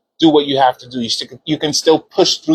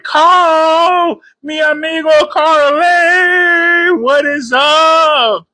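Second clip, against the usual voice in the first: excited greeting call; 12 semitones higher, much slower than usual. Real, not acted. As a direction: call out to a friend who just joined. Greeting Carl joining stream.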